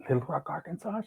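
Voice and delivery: soft questioning echo, rising on "Arkansas"; hushed disbelief. Retold or imitated. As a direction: repeat the place quietly, as if dumbfounded. Stunned echo of the agent's offer.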